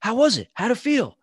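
high, eager reporter voice; a reporter thrusting a mic at the winner. Retold or imitated. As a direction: jump up high like a pushy reporter. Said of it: "And the reporter goes".

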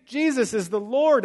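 loud, high rallying cry; excited believers. Acted. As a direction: proclaim it with hammed-up zeal. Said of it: "The Thessalonians are like".

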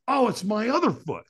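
high-pitched, sudden retold worry; mock alarm. Firewalker checking feet for burns, mock alarm. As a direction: jump to a new worry.

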